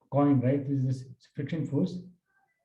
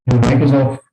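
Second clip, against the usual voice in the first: much louder, calling out to a student; 15 dB louder than usual. Real, not acted. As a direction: call it out loud so they hear. Repeated call, mic still off.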